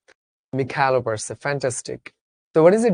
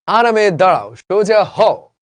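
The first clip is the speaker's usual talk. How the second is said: loud drill sergeant commands; army drill command; 4 semitones higher, 6 dB louder, slower than usual. Retold or imitated. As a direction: bark it like a parade ground order. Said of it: "সেনাবাহিনীতে গেছো". (জোরে, সেনাবাহিনীর ড্রিলের আদেশের মতো)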